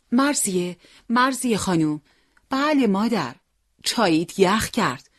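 mother calling Marzieh to her tea. Acted. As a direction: call her from the kitchen.

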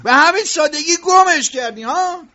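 mock him for giving up so easily; sarcastic scolding. Boss sneering at the worker who lost track, angry sarcasm.

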